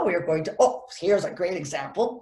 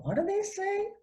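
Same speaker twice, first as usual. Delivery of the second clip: high, softer baffled question; puzzled listener; 12 semitones higher, 7 dB quieter than usual. Acted. Listener baffled by a rushed name, puzzled. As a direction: ask it high, as if you missed the name.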